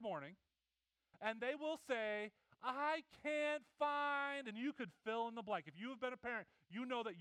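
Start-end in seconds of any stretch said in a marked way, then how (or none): 2.6-4.5 put-on high, drawn-out whine of a kid; a child's high whine; whiny kid. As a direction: whine it like a kid who lost something. Kids whining they can't find things.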